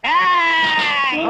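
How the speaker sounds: long drawn-out hey; one stretched, held shout; heroic challenge. Acted. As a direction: call out to stop the thieves. Rescuer challenges the robbers.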